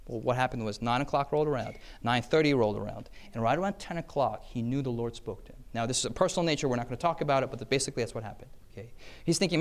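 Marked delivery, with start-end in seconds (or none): none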